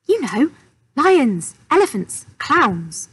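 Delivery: high, loud, word by word; shouting. A narrator's reading. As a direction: shout slowly so a foreigner understands. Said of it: "so he shouted in English".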